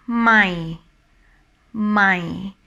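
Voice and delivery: "my" in a low, falling tone. Shown as a demonstration. Teacher showing Thai learners the word for new, low tone. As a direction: let "my" sink gently to a low pitch. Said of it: "with low tone".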